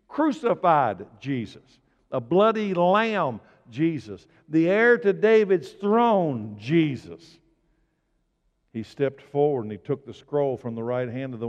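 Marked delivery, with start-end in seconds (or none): 0.1-7.4 slow, ringing proclamation of each title; full-force preaching. Real, not acted.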